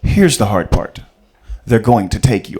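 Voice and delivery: low, loud, commanding; father on the phone, deep and loud; stern movie dad. Retold or imitated. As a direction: deliver bad news coldly and firmly. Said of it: "Your dad tells you".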